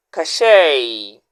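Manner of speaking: "Cachet" said ka-SHAY, ending on "ay" with no t; "Cachet" with the final "et" sounded as "ay"; correct pronunciation. Shown as a demonstration. Teacher giving viewers the right pronunciation. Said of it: "How should you pronounce the word?".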